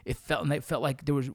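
ordinary talk, nothing marked

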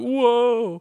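high, loud startled whoa; amazed whoa. Retold or imitated. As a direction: gasp it out in awe. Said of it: "he's like".

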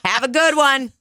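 fake-nice send-off; chirpy brush-off; sarcastic farewell through laughter. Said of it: "I go".

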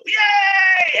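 very high, loud mock cheer; crowd cheer. Crowd welcoming a contestant on stage, mock cheering. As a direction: imitate a roaring, welcoming crowd. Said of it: "the crowd would go".

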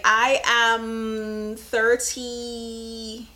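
ordinary talk, nothing marked

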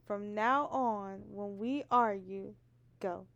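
slow, deliberate, quiet host read; slow announcer. Acted. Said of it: "Chad said".

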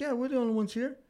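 high, easy woman's voice; older lady's voice. Retold or imitated. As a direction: imitate the lady reassuring. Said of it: "She's like".

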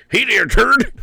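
silly turd voice. Pretend. Echoing the turd joke, a squeaky high greeting. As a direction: pitch it way up like a squeaky toy.

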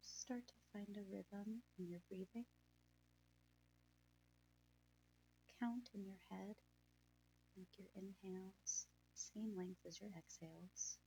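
breathy, hushed calming guide; guided meditation. Acted.

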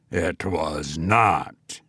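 very low, slow and soft goblin; deep voice. A narrator's reading. Goblin contradicting the sexton, in a deep voice. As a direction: drop deep and draw out each word. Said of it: "said a deep voice".

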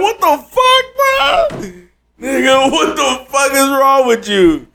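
very high, stretched yelp of shock; mock shocked squeal at the classmate; outraged disbelief. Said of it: "I was like".

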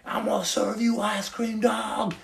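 hammed-up stranger parroting his catchphrase; mock street shout. People in Walmart yelling his catchphrase, imitated high. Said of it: "people are screaming it".

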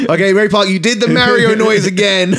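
loud, high, laughing mock director; exasperated director. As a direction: yell it at Ray Park through laughter. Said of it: "nightmare to film".

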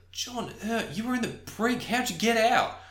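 high-pitched character voice; Ennister. Acted. Ennister confronting escaped John, high-pitched. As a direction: question him in a high, put-on voice. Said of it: "Ennister looks at, uh, John".

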